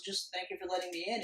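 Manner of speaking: soft, unhurried villain's thanks; Apocalypse, quiet and calm. Retold or imitated. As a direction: lightly imitate a calm, hushed thank-you. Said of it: "very quietly and calmly goes".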